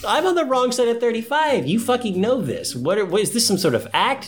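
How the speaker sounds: high, exasperated ranting; hammed-up offended rant; mock indignant. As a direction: protest the joke with exaggerated outrage. Mock indignation at friend's tease.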